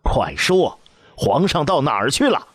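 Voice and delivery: furious empress demanding the emperor's whereabouts; angry demand. A narrator's reading. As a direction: demand the answer at once. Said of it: "喝道".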